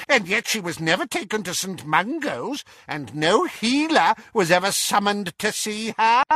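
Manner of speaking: high, pointed gossiping aunt; Auntie Muriel, insinuating. A narrator's reading. Auntie Muriel doubting the illness story, high and pointed.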